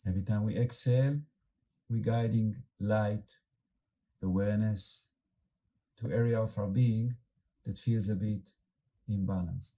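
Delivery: calm, unhurried meditation guide with long pauses; guided meditation. Acted. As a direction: gently lead the group inward, letting words settle.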